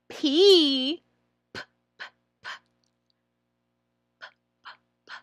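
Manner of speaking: voiceless puffs of "p"; P sound. Shown as a demonstration. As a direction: puff out "p" with no voice.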